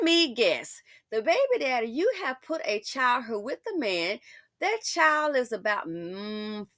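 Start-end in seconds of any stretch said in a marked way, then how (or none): none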